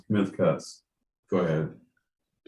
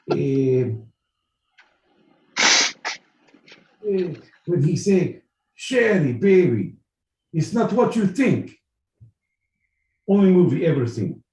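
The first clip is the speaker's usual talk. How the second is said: mock Russian accent, higher and louder on the quote; lightly voiced Russian telling a movie line; 6 semitones higher, 4 dB louder than usual. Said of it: "Russian, my boy Pete".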